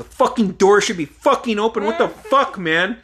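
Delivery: high, loud angry complaint, acted. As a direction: burst out loud, high and fed up. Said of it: "then I said it out loud".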